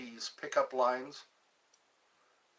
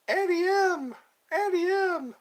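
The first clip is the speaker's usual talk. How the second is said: high shrill calls of "Auntie M!"; old movie cry; 6 dB louder than usual. Pretend. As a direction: cry out like Dorothy in the storm.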